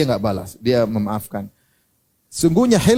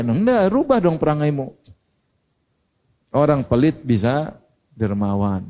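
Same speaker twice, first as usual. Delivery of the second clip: usual speaking voice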